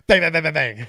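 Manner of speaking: loud, high rapid-fire gun imitation; mock gunshots, punched out loud; imitated gunfire. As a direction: fire off the shots with your voice. Acting out Tree shooting the killer.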